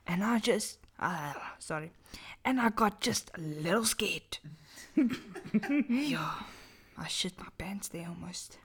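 hushed spooky storytelling; dramatic whisper. Acted. Reading a horror story aloud to friends, whispered. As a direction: whisper it to give chills.

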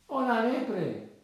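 high, slow drawn-out hail; storybook mole hailing someone; calling out. Acted. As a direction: sing out the call to the hare. Mole calls out to the hare.

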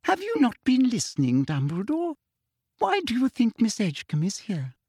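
sugary, high-pitched toad-like sneer; prim, falsely sweet official; Umbridge voice. Acted.

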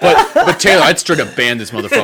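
mock-angry sputter, starting high and loud; Chuck, pissed off. Streamer urges Taylor to ban the troll, mock pissed off. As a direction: imitate an irritated friend, amused underneath, settling by the end. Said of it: "Chuck started getting pissed off".

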